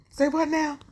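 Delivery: stunned disbelief; jaw-dropped double-take; shocked. Real, not acted. Hearing intruder was a manager.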